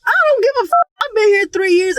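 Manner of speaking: panicked yelling about the roaches. Retold or imitated. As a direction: yell it like you're losing it. Freaking out.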